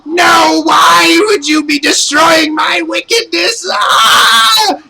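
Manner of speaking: very high, loud shrieking wail of defeat; a villain screaming as she is destroyed; defeated witch wailing. Acted. Bandora wails as she explodes into light, high shrieking cry. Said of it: "sitting there going".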